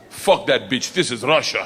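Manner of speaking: lightly voiced Russian accent, dismissive; a hint of a Russian tough guy; Russian gangster. Gangster dismisses the English teacher, light Russian accent. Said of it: "Shuts the door in her face and goes".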